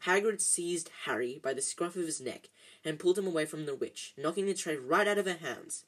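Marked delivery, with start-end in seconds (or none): none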